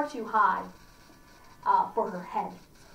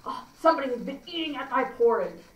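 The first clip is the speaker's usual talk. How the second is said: lightly voiced huge bear, aggrieved; a hint of a big bear finding his food touched; 3 dB louder, faster than usual. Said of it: "our huge bear says".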